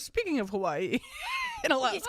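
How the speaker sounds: mocking friend. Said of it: "She goes".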